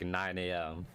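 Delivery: ordinary talk, nothing marked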